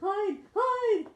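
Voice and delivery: high, loud urgent call. Real, not acted. Urging the cat to hide.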